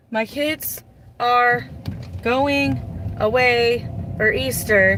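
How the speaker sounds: slow, over-spelled explanation; breaking it down slowly. Shown as a demonstration. Telling her partner the Easter plans, slowly. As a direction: spell it out word by word like to a child. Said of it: "I literally have to break it down".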